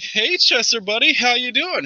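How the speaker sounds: high, careful friendly check-in; cautious party member. Acted. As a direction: ask gently as if humoring a child. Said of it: "one of the party members is like".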